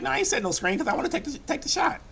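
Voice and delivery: high, fast whiny ball hog; whiny teammate. Retold or imitated. Selfish teammate whining about taking the shot. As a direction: whine like a selfish shooter who won't set screens. Teammate refusing to set screen.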